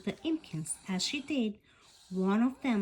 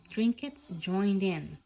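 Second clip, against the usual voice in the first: ordinary delivery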